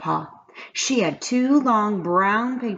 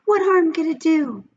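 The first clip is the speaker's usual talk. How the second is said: urging pigtail; 11 semitones higher than usual. A narrator's reading. The pigtail urges Paul in a high squeaky voice. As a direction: wheedle him to do it. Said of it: "urged the pigtail".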